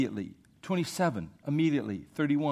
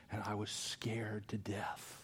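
whisper; 7 semitones lower, 12 dB quieter, slower than usual. Real, not acted. Recalling car wreck.

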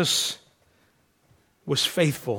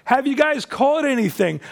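higher-pitched mild call; 6 semitones higher than usual. Acted. Jesus calls to fishermen from the shore, mild call. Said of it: "Jesus calls from the wa-, from the shore".

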